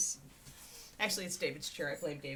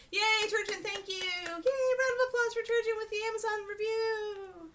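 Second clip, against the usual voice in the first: much higher, cheering like a hype host; excited cheer. Real, not acted. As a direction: lead a round of applause for a fan. Cheering a fan's review.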